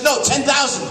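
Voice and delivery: high, loud snappy punchline voice; sudden sharp retort. Retold or imitated. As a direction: jolt awake and correct him sharply. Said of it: "She says".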